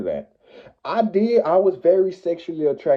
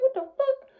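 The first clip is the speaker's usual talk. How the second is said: shocked; 4 dB quieter than usual. Retold or imitated. Ex suddenly appears in store.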